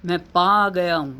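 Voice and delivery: usual speaking voice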